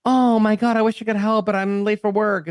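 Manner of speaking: LA driver brushing off a stranded driver; mock Californian. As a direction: lightly overdo the sweet apology. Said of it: "Versus like".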